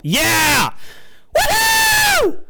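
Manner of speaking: high sarcastic whoop, shouted; mock celebration cheer. Podcaster mocking his own fumbled joke, sarcastic high whoop.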